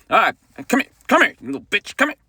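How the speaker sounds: high, hammed-up call to come here; lightly voiced singer beckoning a heckler. Singer calling a heckler over, mock threatening. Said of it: "[name] will cut you".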